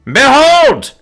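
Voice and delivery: grand, high, booming proclamation; huge heroic shout; over-loud declaration. A narrator's reading. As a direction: declare it far too loudly. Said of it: "louder than he meant".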